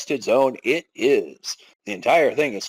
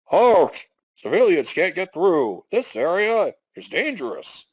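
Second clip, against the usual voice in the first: blockade soldier; 4 semitones higher than usual. Acted. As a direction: stop the civilians cold.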